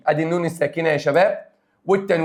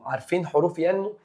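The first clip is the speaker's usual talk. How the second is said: ordinary talk, nothing marked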